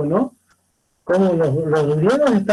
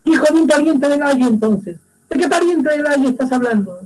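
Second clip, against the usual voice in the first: demanding king; 6 semitones higher than usual. Acted. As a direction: push him for the name. Oedipus pressing the shepherd.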